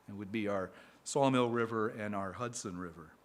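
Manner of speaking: normal talking voice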